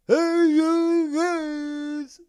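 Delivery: high, very loud stretched holler; full-force drawn-out monster bellow; Sloth from Goonies. Shown as a demonstration. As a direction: yell it long and huge. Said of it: "uh, be Sloth?".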